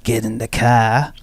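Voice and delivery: slow, dragged-out broad Aussie drawl; lazy, stretched Australian vowels; broad Australian accent. Acted. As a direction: put on a bogan Australian accent. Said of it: "when they hear someone say".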